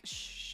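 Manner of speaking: soft retold "shh"; shushing. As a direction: hush someone quietly. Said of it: "he was like".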